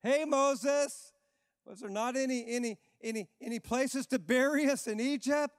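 high mocking sneer at Moses; sarcastic Israelites. Israelites mocking Moses in the desert, sarcastic. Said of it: "This, this is sarcasm here".

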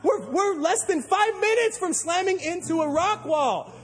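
high, slow, urgent alarm. Retold or imitated. Preacher relives the boat drifting toward rocks, alarmed. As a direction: stress how close the crash is. Panicked about crashing.

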